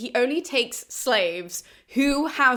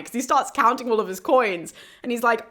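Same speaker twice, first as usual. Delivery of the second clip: ordinary delivery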